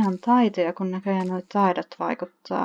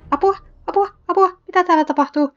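high, loud playful shouts of apua; mock cries for help; 10 semitones higher, 6 dB louder, faster than usual. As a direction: yell for help as the panicking Sim. Sim attacked by bees.

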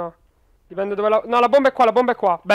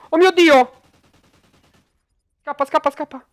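panicked high yelp, then urgent; alarmed and rushing to flee; being chased; 6 semitones higher, 5 dB louder than usual. Real, not acted. Enemy appears, player flees.

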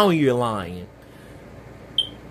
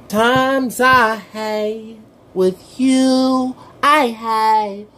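very slow, high, dreamy; wistful drawl; 10 semitones higher, 4 dB louder, much slower than usual. Acted. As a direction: stretch it out, reminiscing.

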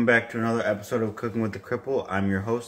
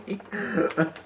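soft trailing laugh; 5 semitones higher, 4 dB quieter than usual. Real, not acted. Laughter fading out.

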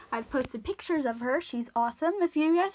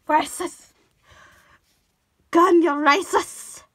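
mock outraged shout; 3 semitones higher, 8 dB louder, slower than usual.

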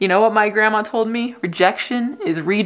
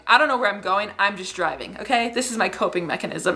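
usual speaking voice

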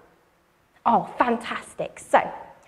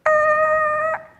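high screeching tyre noise; 14 semitones higher than usual. Shown as a demonstration. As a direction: squeal like tyres on tarmac. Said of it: "Can you do that noise?".

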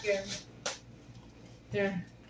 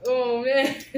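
ordinary talk, nothing marked

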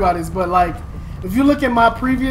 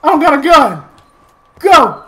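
loud, high panicked screams; panicked yelling; 6 semitones higher, 11 dB louder than usual. Real, not acted. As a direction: yell in real panic. Ambushed without a gun, panicking.

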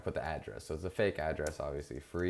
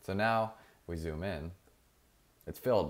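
ordinary delivery

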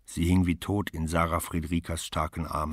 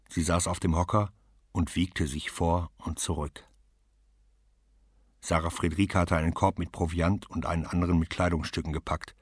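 plain reading voice

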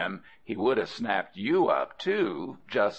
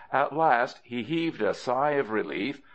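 ordinary delivery, nothing marked

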